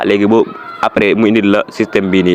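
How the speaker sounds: normal talking voice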